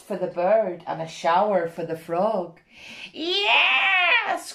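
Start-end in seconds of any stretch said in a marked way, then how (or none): 3.1-4.5 high excited yes; witch's cry. Acted. Witch delighted by the new broom, high joyful cry. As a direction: shout it high with joy. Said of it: "cried the witch".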